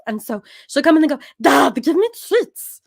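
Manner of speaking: loud, high diva dog voice; Pepper. Shown as a demonstration. Diva dog demanding things from dad, high loud voice. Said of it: "she talks like this".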